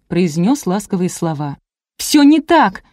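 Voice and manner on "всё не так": high, loud indignant outburst; flaring up. A narrator's reading. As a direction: snap back in indignation. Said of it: "взвилась Леся". (возмущённо, вспылив)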